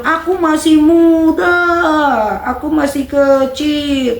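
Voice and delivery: put-on high, slow whiny kid voice; mock child complaining he is too young, whiny. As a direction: drag it out like a sulky child. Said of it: "kalau adik-adik masih bilang".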